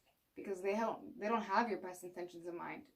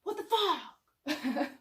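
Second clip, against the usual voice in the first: higher, louder, slow outburst with a laugh; a hammed-up angry driver; mock road rage; 8 semitones higher, 8 dB louder, much slower than usual. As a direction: blurt it out like someone just cut you off. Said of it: "You just go".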